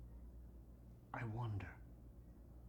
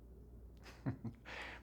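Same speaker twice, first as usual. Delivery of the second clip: soft, lingering little laugh; private chuckle; 9 dB quieter than usual. Acted. Old doctor recalling some doozies, private chuckle. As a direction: chuckle quietly over a memory.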